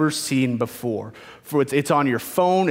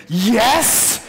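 excited yes; 6 semitones higher, 3 dB louder than usual. Retold or imitated. Bearded man cheers from behind.

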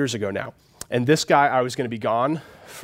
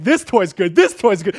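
put-on excited kid; 7 semitones higher, 6 dB louder than usual. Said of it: "running around being like".

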